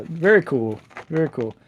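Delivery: usual speaking voice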